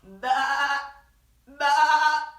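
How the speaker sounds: loud, high imitated baa; sheep bleat. Sheep answering duck with a loud baa. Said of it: "said sheep".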